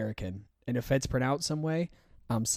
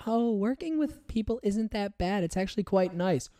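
higher, airy mock of a know-it-all customer; mocking naive customer; 9 semitones higher than usual. Customer who never worked retail giving advice, higher mocking voice. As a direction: parrot a clueless customer sweetly. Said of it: "they give their two cents".

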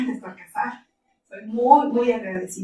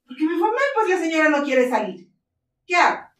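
higher, louder mock complaint; hammed-up helpless husband, higher and louder; imitating the colonel; 7 semitones higher, 5 dB louder than usual. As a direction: play the exasperated colonel, pitched up.

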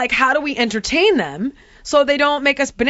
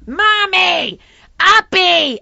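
much higher and louder, a mock whining toddler; whiny toddler; 6 semitones higher, 7 dB louder, much slower than usual. Toddler Phoenix begging mommy to be carried, whining. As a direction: whine it up high like a clingy three-year-old. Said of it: "and screaming for two hours".

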